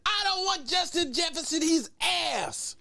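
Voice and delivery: high, strained mock of an indignant fan; mocking a caller. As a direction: lampoon his outburst. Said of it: "Remember when he said".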